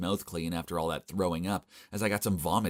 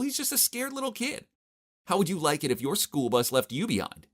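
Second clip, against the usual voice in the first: scolding Mila; 8 semitones higher than usual. Acted.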